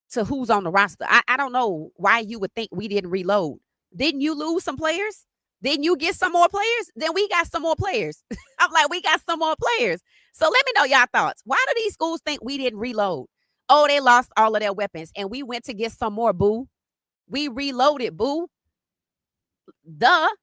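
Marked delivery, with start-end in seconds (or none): 3.9-10.0 incredulous. Retold or imitated. Mocking rival schools. 17.3-20.3 taunt. Retold or imitated. Taunting rival schools.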